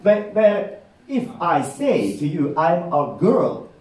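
ordinary delivery

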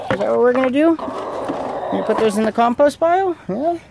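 high-pitched pet talk. Real, not acted. Teasing his hens about taking their eggs, in high pet talk. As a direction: coo at the hens like babies.

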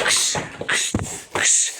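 hissed "kış" to shoo; shooing noise. Pretend. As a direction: shoo off a creature. Shooing the vampire away.

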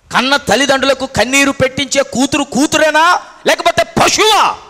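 loud, high rebuke building to a shout; indignant preaching. Real, not acted. Preacher condemning children who make parents weep, indignant preaching. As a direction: drive the question up into a shout.

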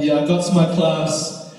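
usual speaking voice

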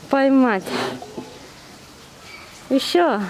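normal talking voice

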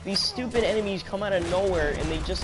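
high-pitched, worked-up gamer complaint; exasperated rant. Real, not acted. Ranting about endlessly spawning enemies.

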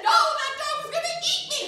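very high, loud and fast; hammed-up terror, laughing; laughing mock panic. Protests to floor director.

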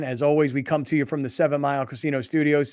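ordinary talk

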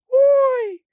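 startled little gasp of a word; Kulka's surprised cry. A narrator's reading. Kulka sees the sea from the top, high startled ой. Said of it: "вразилася Кулька".

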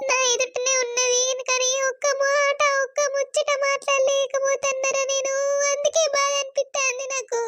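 sobbing complaint rising to a very high wail; tearful, keening outpouring of hurt; crying. Acted. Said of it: "అయ్యో, ఏడవకు సప్న".

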